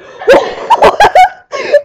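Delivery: very high, loud laughing squeal; shriek-laugh at the scary climax; hysterical laughing shriek. Retold or imitated. The narrator cracking up at her own horror story, shrieking.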